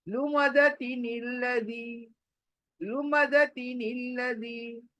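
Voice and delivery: लुमज़ती flows unbroken into निल्लज़ी; joined into one. Shown as a demonstration. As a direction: chant लुमज़ती निल्लज़ी evenly, no pause between. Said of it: "दोनों को जोड़कर एक बनाना".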